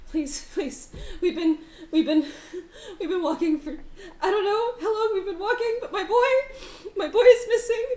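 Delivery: high, loud desperate begging; tearful plea. Acted. GM as the mother begging strangers to find her son, high and tearful. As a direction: plead for your lost boy on the verge of tears. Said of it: "she starts crying".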